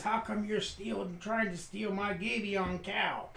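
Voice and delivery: a hint of rustic grievance; accusing farmer.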